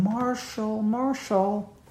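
soft high nurse calling a sleeper's name; young nurse's feminine voice. Retold or imitated. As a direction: gently rouse a patient in a feminine voice. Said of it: "feminine voice saying".

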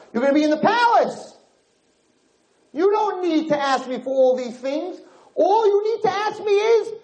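very high, impassioned appeal; exasperated royal pleading. Retold or imitated. King urging his son to come home, high impassioned pleading. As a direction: plead at a high pitch, exasperated with your child.

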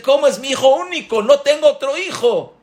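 very high, loud, fast protest; bewildered mother. Acted. Mother protesting to the doctor, very high and fast. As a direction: insist he is your only son.

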